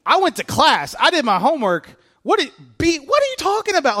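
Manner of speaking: high, loud, incredulous; exasperated. Retold or imitated. Pastor retorting to pious college friend, exasperated and loud. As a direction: throw up your hands in disbelief.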